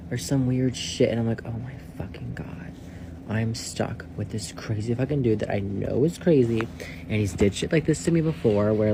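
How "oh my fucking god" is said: breathy disbelieving whisper; shocked whisper. Retold or imitated. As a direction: whisper it like you can't believe this guy. Reacting in disbelief to roommate.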